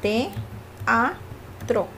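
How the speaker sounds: "teatro" broken as "te... a... tro"; "teatro" with a pause between each syllable; split in three syllables. Shown as a demonstration. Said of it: "lo voy a dividir en tres".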